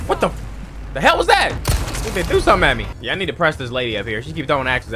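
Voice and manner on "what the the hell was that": surprised. Real, not acted. Surprised by enemy attack.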